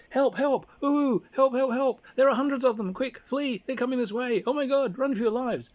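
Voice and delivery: high, loud fake cries for help; panicked shout. Scout shouts to the party, high and fake panicked. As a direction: yell like you're fleeing monsters. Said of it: "hears the shout from the front".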